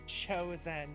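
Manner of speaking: ordinary delivery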